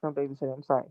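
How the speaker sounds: normal talking voice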